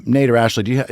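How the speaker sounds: ordinary delivery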